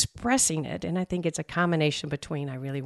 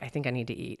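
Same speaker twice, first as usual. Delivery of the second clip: softer, lower quiet realization; 5 semitones lower, 9 dB quieter, faster than usual. Retold or imitated. Realizing quietly she is hungry. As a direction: murmur it to yourself, softly. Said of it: "It was like".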